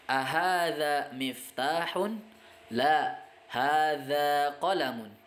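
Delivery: Ahadza miftahun rising slowly, then La, hadza qalamun; teacher modelling Arabic exchange; slow question and answer drill. Shown as a demonstration. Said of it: "Tidak, ini adalah pulpen".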